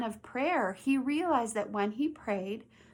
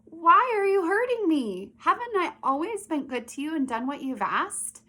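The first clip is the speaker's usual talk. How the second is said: higher, louder hurt donkey voice, then easing back; talking donkey; 5 semitones higher, 5 dB louder than usual. Acted. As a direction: plead like a wronged donkey, starting high and loud. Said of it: "And the donkey said".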